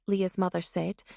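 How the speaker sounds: usual speaking voice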